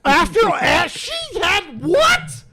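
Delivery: high, slow stretched disbelief; real disbelief. Real, not acted. Reacting to what she offered the guest, stunned and high.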